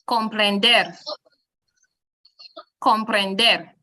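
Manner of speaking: comprender with final DER stressed; stress on last syllable. Shown as a demonstration. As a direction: land firmly on DER in comprender. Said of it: "stress moves to the last syllable".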